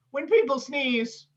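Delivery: usual speaking voice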